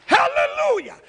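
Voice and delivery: high, loud cry of praise; exultant shout. Real, not acted. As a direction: burst out in jubilant praise. Preacher exclaims praise to congregation.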